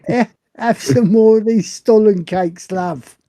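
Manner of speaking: loud, high mock European accent; mock European innkeeper. Innkeeper offering the guest food, hammed-up foreign accent. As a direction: ham up a fake continental host. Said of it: "pretending to do some accent".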